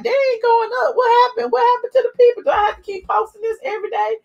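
high, fast, pleading complaint; exasperated whine. Retold or imitated. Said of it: "I'm like".

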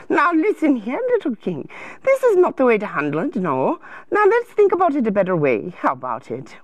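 lilting, gently concerned advisor voice; sing-song storybook helper; fussing counselor. Acted. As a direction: coo at a troubled little king.

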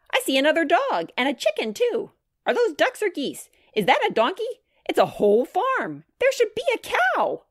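put-on high-pitched, eager little-girl voice; excited child. A narrator's reading. Grace peers over the gate at animals, excited. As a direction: pipe up with mounting delight.

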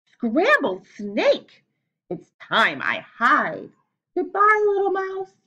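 very slow, loud alarm rising to a high goodbye; panicked snake. Acted. Snake frightened off by the mouse, hammed-up panic.

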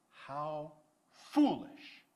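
slow, loud rebuke; exclaiming. Retold or imitated. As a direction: drag it out in stern disbelief. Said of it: "Samuel exclaimed".

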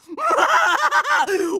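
hammed-up triumphant cackle; long gloating villain laugh; evil villain laugh. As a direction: cackle like a cartoon bad guy. Villain cackles mid-threat.

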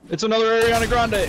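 excited shout. Real, not acted. Spotting a player in game.

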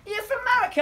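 high-pitched, mock-amazed question; impressed English admirer. English people wowed by an American accent, excited. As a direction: ask it high, in mimicked amazement. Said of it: "loved my American accent, and they thought it was so cool".

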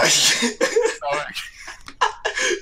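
long, high laughing squeal; helpless laughing fit. Real, not acted. Gamer laughing along as friend jokes about abs, helpless laughing fit. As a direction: laugh until the belly aches.

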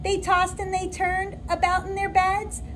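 lilting, sing-song storybook read; reading to children. Acted. Teacher reading a picture book to her new kindergarten class, lilting and gentle. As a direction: read it aloud like a bedtime story for little kids.

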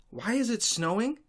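sneering echo of the question; mocking repeat. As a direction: parrot the dumb question back with scorn. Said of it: "What the hell do you want me to say".

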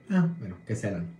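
ordinary talk, nothing marked

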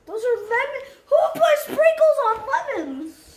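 high, loud, laughing disbelief; surprised. Real, not acted. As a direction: shriek it in laughing shock. Shocked at sprinkled lemons.

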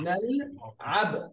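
نلعب said slowly as one word after the split; نلعب slowly joined; slow model word. Shown as a demonstration. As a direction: put "nal" and "ab" back together slowly into نلعب. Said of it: "the first part is nal".

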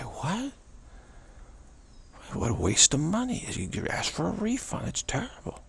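high-pitched, rising disbelief; incredulous family. Acted. Family hearing that nothing was played, incredulous. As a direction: react with a high incredulous squawk, fading at the end. Family reacts to wasted ticket.

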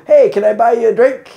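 loud, high shouted offer; mock hearty call. Cruise passenger jokingly offering the performer a drink, loud hearty call. Imitating jokey fans calling out.

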